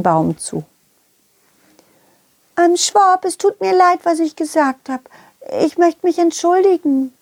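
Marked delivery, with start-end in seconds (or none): 2.6-7.1 high, sheepish little creature; high Schwubi voice. Acted. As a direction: apologise as a shy little Schwubi. Schwipp apologises, high voice.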